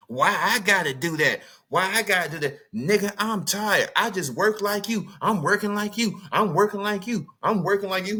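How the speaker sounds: hammed-up tired wife refusing to cook; mock complaining wife. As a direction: play the worn-out wife snapping back. Voicing the wife's complaining reply.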